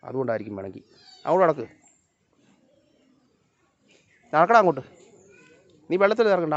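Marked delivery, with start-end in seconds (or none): 1.2-6.5 raised, higher warning call; calling the dog. Real, not acted. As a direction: warn the dog off the water. Ordering the dog, water warning.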